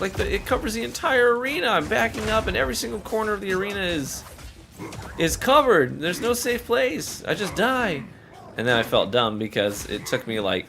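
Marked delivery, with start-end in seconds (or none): 0.0-8.0 high, frantic panicked gamer voice; panicking about the attack; frustrated past self. Retold or imitated. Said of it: "I was like".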